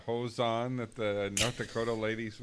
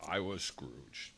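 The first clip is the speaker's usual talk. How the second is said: put-on slow, softer grumpy old miser; Scrooge voice; 4 semitones lower, 6 dB quieter, much slower than usual. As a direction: grumble it like Scrooge. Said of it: "I played Scrooge".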